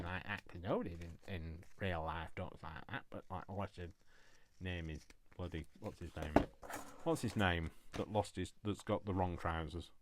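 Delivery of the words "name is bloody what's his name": normal talking voice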